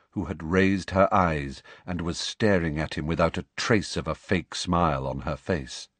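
plain reading voice

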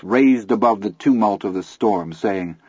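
ordinary delivery, nothing marked

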